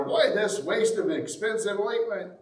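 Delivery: mildly angry disciples; angrily. A narrator's reading. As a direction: scold her for the waste. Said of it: "angrily said to one another".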